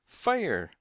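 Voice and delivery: high, loud alarm cry; yell. A narrator's reading. Waiting room panics, yelling the alarm. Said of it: "they yelled".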